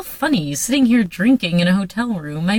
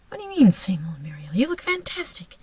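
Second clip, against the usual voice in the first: urgent whisper; 3 semitones lower, 5 dB quieter than usual. Acted. Jesse reassuring Muriel she looks fantastic, whispered. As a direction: press it in a hushed whisper.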